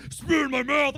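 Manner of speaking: high, loud, strained command; mock intense demand. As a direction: blurt it like an over-eager lover. Acting out girlfriend's escalating demand.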